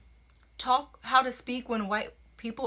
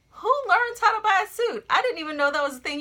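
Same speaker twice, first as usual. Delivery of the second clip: surprised; 11 semitones higher, 6 dB louder, faster than usual. Real, not acted. Surprised at the poem's line.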